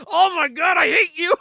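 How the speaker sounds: loud, high, exasperated shout; mad outburst. Retold or imitated. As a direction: yell it in hammed-up fury. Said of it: "Deadpool gets mad".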